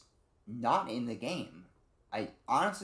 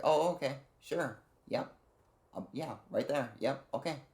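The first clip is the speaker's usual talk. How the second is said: higher-pitched, agreeable acknowledgements; Ashley; 5 semitones higher than usual. Acted. Imitating Ashley answering Leon's orders, higher and agreeable. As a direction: play a compliant follower, lightly raised pitch. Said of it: "And then Ashley".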